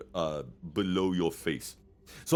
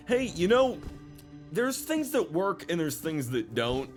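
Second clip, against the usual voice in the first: developer voice; 7 semitones higher than usual. Retold or imitated. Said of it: "look back on The Elder Scrolls and say".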